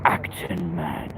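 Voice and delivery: 슈퍼히어로 예고편처럼 낮고 느린 목소리로 부르는 Acton man; slow, deep movie-trailer call; deep superhero announcer. Pretend. Superhero intro for viewer.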